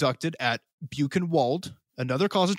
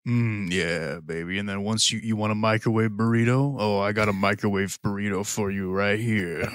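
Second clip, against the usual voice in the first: low, breathy seductive purr; sultry voice; 6 semitones lower than usual. Pretend. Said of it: "a sexual voice".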